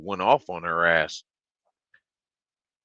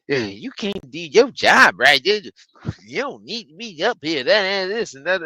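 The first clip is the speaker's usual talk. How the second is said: higher, fussy scolding mock voice; hammed-up complaining customer; mimicked rude customer; 8 semitones higher, 4 dB louder than usual. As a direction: imitate a customer berating the cashier.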